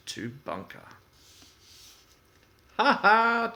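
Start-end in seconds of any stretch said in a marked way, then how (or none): 2.8-3.5 loud, high ha ha of glee; mocking laugh. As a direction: laugh out at a friend's mess. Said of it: "chuckled Percy".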